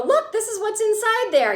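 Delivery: high-pitched, showy demonstrator; imitated eager show-and-tell; Charlotte. Voicing Charlotte's imagined demo.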